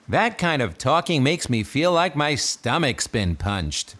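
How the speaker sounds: normal talking voice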